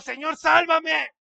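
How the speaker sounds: loud, slow, drawn-out plea of a sinking man; desperate cry for help. Acted. Peter sinking, begging Jesus to save him, loud desperate cry. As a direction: cry out as if going under.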